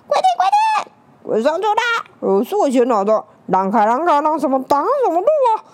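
loud, high, pushy shouting. Acted. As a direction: yell and shove your way aboard. Crowd scrambling for the UFO.

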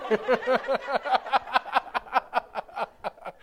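long cackling laugh; helpless laughing fit after a joke; high, rattling laugh; laughter. Real, not acted. Laughing after own impression.

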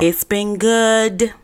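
slow drawn-out. Acted.

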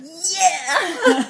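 high, laughing cheer; inner celebration. Retold or imitated. Acting out her secret inner reaction to his invitation, elated high cheer. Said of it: "I gave it that inside. I'm going".

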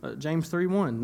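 usual speaking voice

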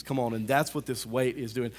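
ordinary delivery

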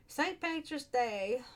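high, drawn-out put-on Irish accent; attempted Irish accent. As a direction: stretch it out in an uncertain brogue. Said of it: "What kind of accent am I trying to do there?".

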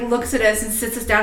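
ordinary delivery

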